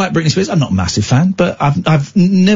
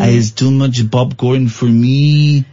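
put-on slow, comic French accent; hammed-up French-accented English; slower than usual. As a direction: offer it in a thick French accent. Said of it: "in my French accent".

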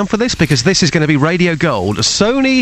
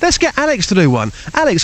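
usual speaking voice

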